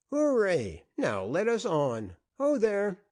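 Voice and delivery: rally the parade like a proud marshal; hearty cheer. A narrator's reading. Beasley leads a mock procession.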